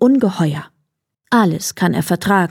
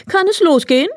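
hare asking; 10 semitones higher, faster than usual. A narrator's reading. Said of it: "fragte der Hase".

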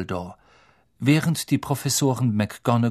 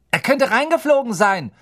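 put-on much higher, louder boyish voice; Dean's suggestion; 12 semitones higher, 6 dB louder than usual. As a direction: offer the idea keenly. Dean Thomas suggests.

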